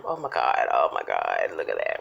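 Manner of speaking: whispering. Real, not acted. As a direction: hush it, awed. Awed whisper at the food.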